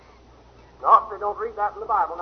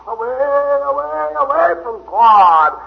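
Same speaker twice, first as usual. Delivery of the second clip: drawn-out refrain; 5 semitones higher, 6 dB louder, much slower than usual. Acted. Repeated phrase drawn out.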